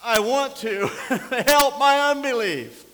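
loud, high, slow cry breaking with a laugh; anguished plea. Retold or imitated. Father recalling his prayer over his injured son, anguished cry. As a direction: cry out for help with your doubt. Retold desperate prayer at the helicopter.

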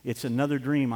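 ordinary talk, nothing marked

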